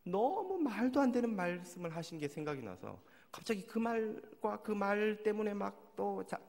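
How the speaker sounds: ordinary talk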